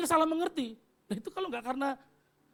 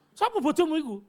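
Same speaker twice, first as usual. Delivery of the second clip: mock angry wife; 5 semitones higher, 8 dB louder than usual. Wife's retold quarrel line.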